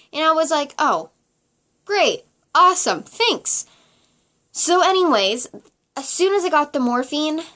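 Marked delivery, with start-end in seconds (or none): 0.8-3.7 sarcastic thanks. Retold or imitated. Sarcastic reply to the nurse's warning.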